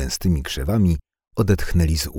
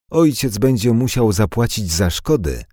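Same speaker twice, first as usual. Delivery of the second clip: higher, louder threat; threatening shout; 7 semitones higher, 6 dB louder than usual. Acted. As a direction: lean in and shout the warning. Thug threatening the boys.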